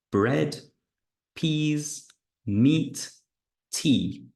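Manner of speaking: bread, peas, meat, tea each said clearly; careful word list. Shown as a demonstration. Teacher reading words for students to circle. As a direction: read each word on its own for the class. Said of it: "Listen and circle what is different".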